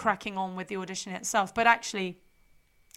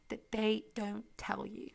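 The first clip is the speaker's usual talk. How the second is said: whisper; 9 dB quieter than usual. Acted. Secretive tease of episode topic.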